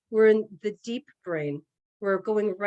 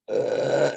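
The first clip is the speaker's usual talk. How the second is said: low belch that escapes mid-sentence; deep belch; 11 semitones lower than usual. Real, not acted. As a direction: let out a real deep burp. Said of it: "uh, belch".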